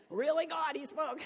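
hammed-up plea thrown at the heavens; shrill mock outrage. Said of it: "It's like".

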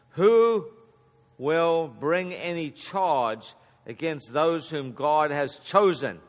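big drawn-out WHO, pause, measured question; rhetorical challenge. Acted. Reads Paul's question with rhetorical emphasis.

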